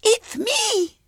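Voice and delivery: put-on very high little-girl pipe; piping. A narrator's reading. Little girl announcing herself to her father, piping. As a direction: pipe up in a tiny child's voice. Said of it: "piped Violet Elizabeth".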